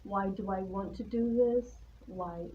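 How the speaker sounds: ordinary delivery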